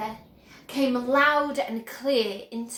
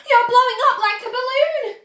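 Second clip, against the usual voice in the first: high, loud alarmed cry; panicked cry; 11 semitones higher, 10 dB louder than usual. A narrator's reading. Mother crying out as daughter swells, high and loud.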